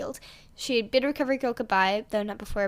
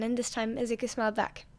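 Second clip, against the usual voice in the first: usual speaking voice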